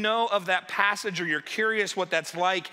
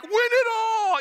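high, loud drawn-out cheer; mock fan cheer; 6 dB louder than usual. Browns fans suddenly backing the Bengals, hammed-up cheer. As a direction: whoop it like a suddenly converted fan.

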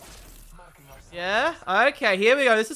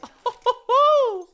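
high laugh; 13 semitones higher, 7 dB louder than usual. Real, not acted. Laughs at own joke.

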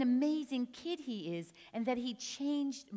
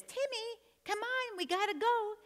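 high-pitched mom call; high, hurrying mother's voice; mother calling her child; 12 semitones higher than usual. Acted. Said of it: "a woman's voice says".

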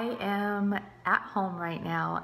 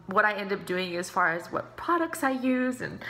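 normal talking voice

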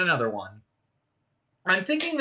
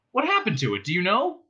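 high-pitched eager questioning; sudden excitement; 6 semitones higher than usual. Acted. Ford questions Marvin about the ship, excited. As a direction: press eagerly for the answer. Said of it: "said Ford in sudden excitement".

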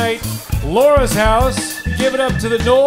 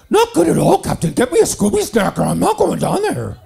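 Scotty; 2 semitones higher than usual. Acted. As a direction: cry out like a harried ship's engineer. Scotty answers the captain, parody.

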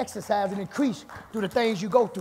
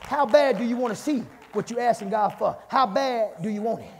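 ordinary talk

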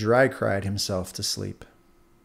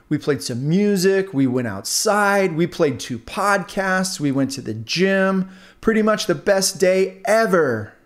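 slow, higher, showing-off voices; bragging earbuds; 6 semitones higher, 4 dB louder, slower than usual. Acted. Earbuds show off their day to the stick, smug. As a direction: gloat about the best day ever.

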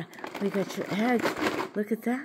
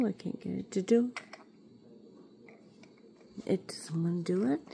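unvoiced muttered whisper; 3 semitones lower, 8 dB quieter than usual. Real, not acted. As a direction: breathe it to yourself. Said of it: "whispered aside".

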